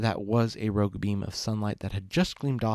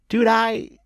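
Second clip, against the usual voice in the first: wheezing laugh; 12 semitones higher, 8 dB louder than usual. Acted. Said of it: "in between wheezes".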